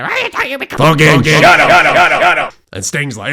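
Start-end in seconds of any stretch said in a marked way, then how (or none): 0.0-0.7 put-on very high squeaky old bag voice; shrill old woman. Old bag affronted at Paul, very high mock old-woman squeak. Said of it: "this old bag's like". 0.7-2.5 loud, higher repeated yell; furious shout. Acted. Paul roaring at the old bag to shut up, loud shout.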